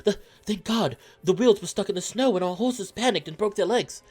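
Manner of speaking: high, loud, stammering relief; frantic high-pitched character voice; panicked traveler. Acted. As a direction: blurt it out high and loud, just rescued from the storm.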